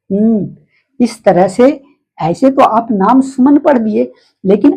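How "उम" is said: उम as a closed-lip hum 'mm'; letter sound. Shown as a demonstration. Teacher gives pupils the sound of M, hummed उम. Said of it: "इसका उच्चारण होगा".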